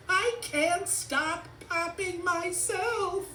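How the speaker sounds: very high, loud compulsive voice; high monotone frantic toy; Bubble Wrap Woman. A narrator's reading. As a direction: chant it high, as if unable to stop. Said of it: "Then there was Bubble Wrap Woman".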